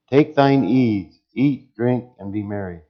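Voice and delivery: slow, proclaimed Take thine ease, eat, drink, and be merry; proclaimed scripture; loud scripture reading. Acted. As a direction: read it out like scripture from the pulpit. Reads the rich man's words.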